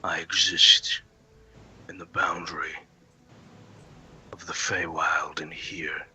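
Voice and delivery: low, hushed Fey Lord, long pauses. Acted. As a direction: declare your rule softly and low, word by word. Game master voices Fey Lord.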